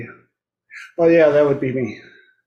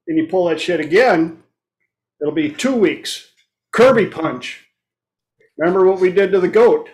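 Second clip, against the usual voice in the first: ordinary delivery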